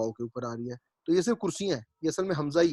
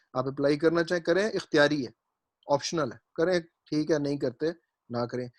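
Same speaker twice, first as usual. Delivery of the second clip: ordinary talk, nothing marked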